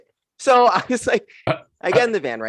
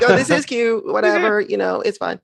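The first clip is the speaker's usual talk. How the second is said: higher, breezy "whatever" voice; mock nonchalance; 8 semitones higher than usual. Comic acting her own coy reaction to free drinks, higher and breezy. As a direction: play it cool but secretly thrilled. Acted quote of herself.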